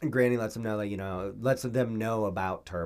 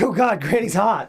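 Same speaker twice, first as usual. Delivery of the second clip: raised, louder mock outburst; higher-pitched comic exclamation; mock dismay; 10 semitones higher, 8 dB louder than usual. Host voicing the story's whole conflict, mock dismay.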